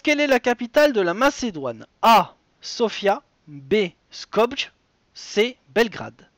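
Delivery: animateur de quiz qui énonce la question et les choix; présente chaque réponse comme un jeu télé; lecture de quiz. Acted. Host reads quiz question. (voix d'animateur de jeu, un peu plus fort)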